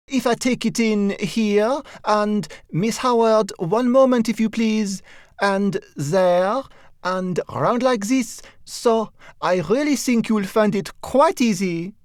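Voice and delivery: a dressmaker coaxing her client, pitched high and persuasive; inspiring dressmaker. A narrator's reading. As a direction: sweep the client along, pitched well up. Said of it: "in an inspiring mood".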